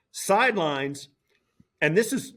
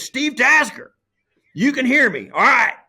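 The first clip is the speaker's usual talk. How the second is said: loud, high imitation of a South Texas TV director; imitating a booming Texan boss barking orders; Lance Barrow; 9 semitones higher, 7 dB louder than usual. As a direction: take command of the broadcast, loud and high. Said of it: "'cause he's from South Texas and stuff".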